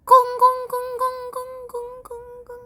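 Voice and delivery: high gong tolls fading away; ringing gong. Retold or imitated. Narrator marking a new scene, fading gong imitation.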